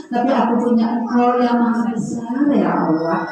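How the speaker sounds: humble sure prayer. Shown as a demonstration. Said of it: "Nah, gitu".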